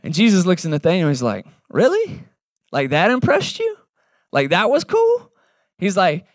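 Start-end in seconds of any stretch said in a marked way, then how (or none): 1.7-5.3 high, teasing mock surprise; amused disbelief. Jesus teasing Nathanael that so little impressed him, high mock surprise. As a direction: lift way up, gently poking fun.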